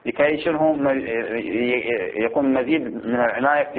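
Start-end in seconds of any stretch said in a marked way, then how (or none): none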